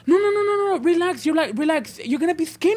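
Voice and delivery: high-pitched, insistent reassurance; coaxing staff imitation. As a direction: mimic staff talking down a nervous patient. Imitates staff soothing panicked patient.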